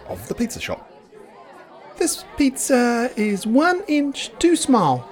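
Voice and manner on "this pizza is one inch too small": much higher, slow prim complaint; fussy meter maid twittering a complaint. Acted. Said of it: "twittered Mildred".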